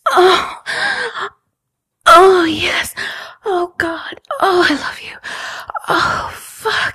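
high, loud ecstatic moaning; passionate cries. Acted. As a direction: cry out in pleasure. Passionate scene, loud moans.

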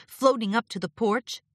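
ordinary delivery

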